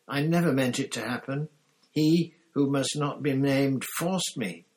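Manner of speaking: high, loud, desperate whining; tearful pleading. A narrator's reading. Pettigrew begs Sirius to understand, tearfully. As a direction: wail out excuses in self-pity. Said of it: "burst into tears".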